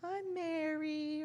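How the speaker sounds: put-on high, slow singsong child's voice; little child. Costumed child answering on the church platform, childlike singsong. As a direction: imitate a small child proudly reciting a name. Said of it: "And they would say".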